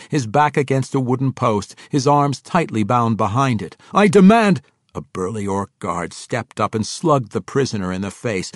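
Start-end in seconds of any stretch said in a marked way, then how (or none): 3.9-4.6 high, loud outburst cut short; roared demand. A narrator's reading. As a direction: shout a captive's demand. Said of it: "the drow prisoner roared".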